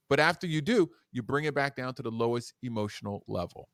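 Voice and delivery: ordinary talk, nothing marked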